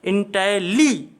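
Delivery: इंटेली slowly, leaning on the final ली; slow, broken-up इंटेली, stopping after ली; syllable-by-syllable pronunciation. Shown as a demonstration. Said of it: "एक स्वर ये हो गया".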